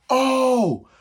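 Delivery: high wail of pain; reliving the toe pain in a wail; pained cry. Retold or imitated. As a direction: cry out as if it still hurts. Toes crushed, crying out.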